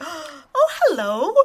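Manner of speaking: put-on delighted hello to a small child; greeting a child. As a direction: coo a friendly hello after a gasp. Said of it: "when you see a child out in the world".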